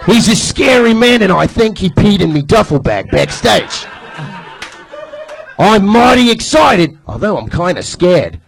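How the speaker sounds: hammed-up Australian accent, excited and slow in spots; Australian accent, hyped promo; Australian wrestler promo. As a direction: cut a wrestling promo as an Australian crocodile hunter. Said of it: "Tell us in that voice".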